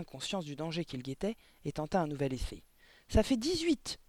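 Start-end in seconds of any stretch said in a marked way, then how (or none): 3.1-4.0 put-on high, panicky child's shout; cried out. A narrator's reading. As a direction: blurt it out high, desperate to be right. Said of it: "cria-t-il". (cri aigu d'enfant)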